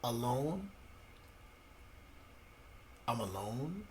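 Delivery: very soft, lingering on the word; quietly forlorn. Retold or imitated. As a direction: let it drop almost to nothing. Answers how being abandoned felt.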